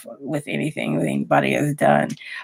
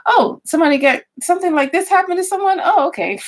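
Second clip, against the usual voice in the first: mock casual shrug; 12 semitones higher than usual. Mocking her own numb reaction.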